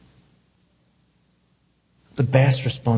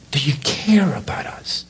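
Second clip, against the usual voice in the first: hushed, unvoiced repeat of the question; breathless whispered plea; 5 semitones higher than usual. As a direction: whisper it like a fearful prayer.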